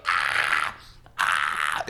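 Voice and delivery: put-on low raspy old laugh; grandma's raspy chuckle; smoker laugh imitation. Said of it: "when she laughed, she would".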